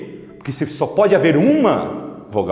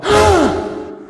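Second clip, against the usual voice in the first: loud, high-pitched gasp; mock shocked gasp; 4 dB louder than usual. Teacher acting shocked that I is no vowel, big gasp.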